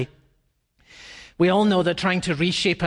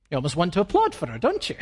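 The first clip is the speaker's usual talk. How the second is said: ordinary talk, nothing marked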